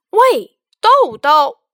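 loud, slow, stretched-out call; child calling loudly into the box. Acted. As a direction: shout into the box after shaking it. Shakes box, calls beans.

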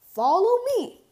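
proud dad pointing out the beach house; Papa Bear. A narrator's reading. Papa Bear shows family the beach house, lightly voiced papa.

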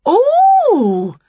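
very high, loud oh; sudden bright idea. A narrator's reading. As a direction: exclaim high as an idea strikes. Winnie struck by an idea.

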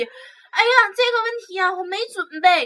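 high-pitched flustered teacher voice; mimicking the scared teacher. Imitating the teacher ducking a student's question, high and flustered. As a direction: whine it out like you've been caught unprepared. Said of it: "就这样".

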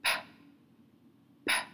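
啪 mit fest geschlossenen Lippen, dann plötzlich geöffnet; 啪 als harter, stimmloser Lippenplosiv; p sound. Shown as a demonstration. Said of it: "它的发音是".

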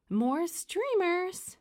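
high, drawn-out demand. A narrator's reading. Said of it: "D W demanded".

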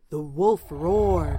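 slow, low ominous telling; slow it down to build dread; menacing narration. A narrator's reading. Narrator bringing on the roaring wolf, slow and ominous.

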